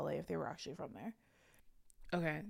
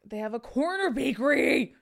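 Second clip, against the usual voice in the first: much higher and louder, bursting with excitement; excited; 8 semitones higher, 9 dB louder than usual. Real, not acted. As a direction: blurt it out thrilled. Excitedly listing mall restaurants.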